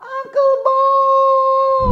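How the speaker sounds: stretched, very loud high shout. Shown as a demonstration. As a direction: yell it so he hears from far away. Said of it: "Kurang kuat, dia nggak denger".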